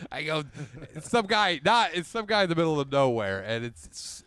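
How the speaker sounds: ordinary talk, nothing marked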